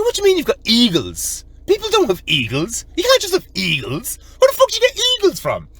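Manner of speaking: high-pitched, hammed-up disbelief; incredulous. Challenging the man who says he has eagles, incredulous.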